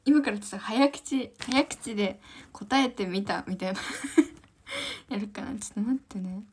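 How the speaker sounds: normal talking voice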